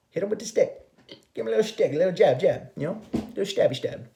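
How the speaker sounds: high, silly sing-song jabbing; playful mock aggression. Fending the partner off with a stick, playful. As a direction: make tiny pretend pokes with the voice.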